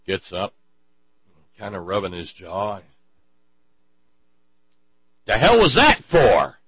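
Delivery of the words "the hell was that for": slow, loud, higher demand; indignant shout. Acted. Punched man to his attacker, slow loud demand. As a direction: demand an answer slowly and loudly. Goldberg gets up, asks why.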